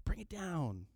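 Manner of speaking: soft shushing, starting high and dropping; hushing bystanders. Acted. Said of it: "people around him were like".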